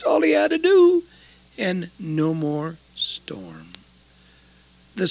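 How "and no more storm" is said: slow whispered wonder; hushed awe. Real, not acted. Preacher telling the storm story, slow hushed whisper. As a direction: let it fall to a slow whisper.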